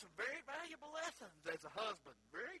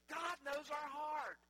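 normal talking voice

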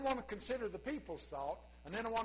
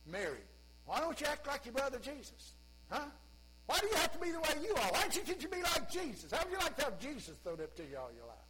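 rising, fast scolding; nagging parent; 6 semitones higher than usual. Pretend. A parent scolding Mary, fast and higher. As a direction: nag a child to be like his brother. Sibling nagged about Jesus.

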